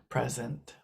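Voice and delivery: ordinary talk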